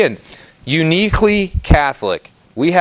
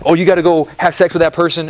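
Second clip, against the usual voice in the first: mock pushy voice; 4 semitones higher, faster than usual. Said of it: "over here, they're like".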